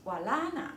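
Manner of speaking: "wala na" run together, long "la"; linked, no stop. Shown as a demonstration. Teacher showing learners linking, "wala na" joined smoothly. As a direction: stretch the "la" of "wala na" instead of stopping. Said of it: "而是跟后面连在一起".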